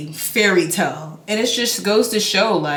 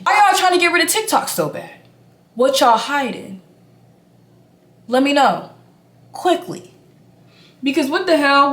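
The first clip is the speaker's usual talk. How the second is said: exasperated rant; 7 semitones higher, 3 dB louder than usual. Real, not acted. Ranting at the TikTok ban.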